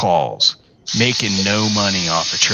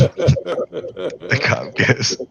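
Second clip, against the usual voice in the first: slow, words stretched by laughter; giggling too hard to get it out; laughing through words; 9 semitones higher, much slower than usual. Real, not acted. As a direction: try to say it while cracking up. Laughing while retelling.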